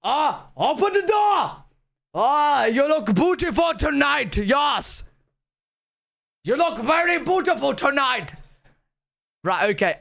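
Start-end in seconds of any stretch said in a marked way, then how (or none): 0.0-8.3 high, forceful German accent; angry German accent. Shown as a demonstration. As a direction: make kind words sound furious in a German accent. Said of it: "everything sounds angry".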